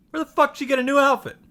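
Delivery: high, loud, fast disbelief; incredulous outburst. Real, not acted. Vlogger baffled by Saber's new outfit, high and loud. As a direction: blurt it out in disbelief.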